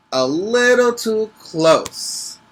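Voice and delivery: drawn out, high on little; slow emphatic stretch. A narrator's reading. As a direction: lean into too close, slowly. Reading with dramatic emphasis.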